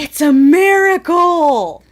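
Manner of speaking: loud, slow mock wonder; mock amazement. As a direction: proclaim it like a miracle.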